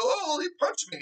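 high, whiny mock victim voice; mock tattletale whine; mock whiny complaint. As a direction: whine it like a crybaby. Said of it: "they wanna go".

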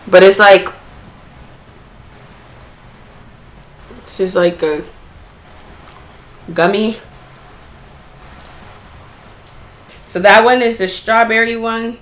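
talking between bites; mouth full. Real, not acted. Seen 0: Bites pink mochi at 2.4 and 10.7, chews between bites.

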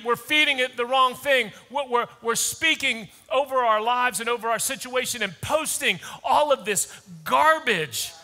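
ordinary talk, nothing marked

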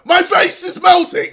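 high, loud mock scream of pain; hammed-up shriek of agony, high and loud; pretend scream. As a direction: act out screaming as your face burns. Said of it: "You should be like".

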